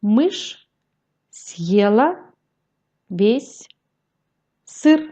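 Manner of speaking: Мышь, съела, весь, сыр, each set apart by a long pause; teacher's spaced-out reading for beginners; syllable by syllable. Shown as a demonstration. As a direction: read slowly, piece by piece. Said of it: "syllabe par syllabe".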